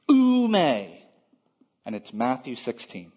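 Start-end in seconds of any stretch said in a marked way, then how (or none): 0.1-1.0 "Ou me" raised high, then falling; a firm, drawn-out "Ou me" of refusal; emphatic negative. Retold or imitated. Preacher quoting Peter's protest to Jesus, emphatic. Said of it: "Peter speaks to Jesus like this".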